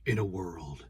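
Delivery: low, hushed trailer narrator; movie-trailer voice. Shown as a demonstration. Showing co-host how to say the trailer line, low hushed announcer voice. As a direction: intone it low and soft like a trailer. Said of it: "You gotta say it like that".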